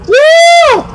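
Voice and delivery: sudden high yelp; high mock yelp. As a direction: yelp like you've just been bitten. Cry at being bitten.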